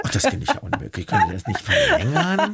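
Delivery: fast, laughing mock outrage; laughing mock protest. Real, not acted. Guest jokingly protesting to the host that the show ends, laughing. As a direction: laugh through a playful complaint.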